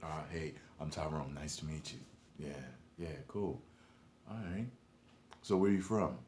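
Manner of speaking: very soft, low, timid greeting; hushed polite introduction; polite small talk. Retold or imitated. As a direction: act innocent and shy. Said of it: "But it's".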